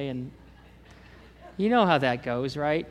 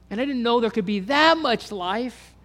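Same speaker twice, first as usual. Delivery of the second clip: emotional realisation; 8 semitones higher, 8 dB louder than usual. Real, not acted. Preacher reliving the moment he realised he was freed, in awe.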